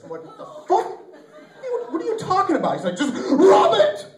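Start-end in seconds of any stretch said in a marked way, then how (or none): 0.2-2.7 high-pitched, drawn-out mock bafflement; acted-out incredulous outburst; shocked disbelief. His shocked reply to buddy's instruction. 3.0-4.1 loud, high mock order; buddy's worked-up instruction, acted out; exasperated insistence.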